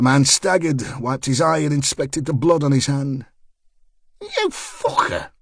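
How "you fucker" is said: slow spat curse, pitched far up. Acted. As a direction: spit the insult in pain. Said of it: "he spat".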